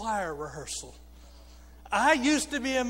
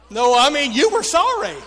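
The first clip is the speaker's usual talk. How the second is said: loud, high-pitched teasing rebuke; mock scolding; 5 semitones higher, 13 dB louder than usual. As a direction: playfully call out a sorry effort. Teasing congregation's weak response.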